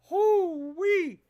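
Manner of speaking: high, drawn hoo-wee call; amazed hoo-wee. Real, not acted. Streamer whooping at a million dead, amazed hoo-wee. Whistling at losses.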